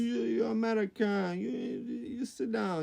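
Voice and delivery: high, halting French caricature; hammed-up Parisian telling someone off; mock French accent.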